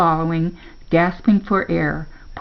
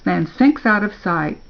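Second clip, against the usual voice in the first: usual speaking voice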